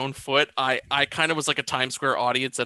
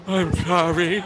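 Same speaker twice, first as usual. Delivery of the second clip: mimicked film victim; 3 semitones higher, 4 dB louder than usual. Echoes the actor's line from clip.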